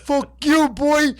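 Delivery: loud, high-pitched cocky comic shout; Dice Man impression. Dice Man telling off his opponent, loud and high. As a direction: holler it in a hammed-up character voice. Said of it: "Dice Man's just like".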